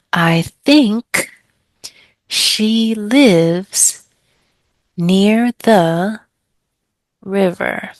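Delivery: slow, separated words with a rise on each; teacher slowing the sentence down for a student; slowly, word by word. Shown as a demonstration. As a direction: spell it out slowly for a learner. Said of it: "Let's try this again, but slowly".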